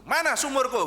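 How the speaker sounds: high, loud furious question; shouting at the king for his stolen well; angry demand. Retold or imitated. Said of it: "Ngamuk langsung gitu".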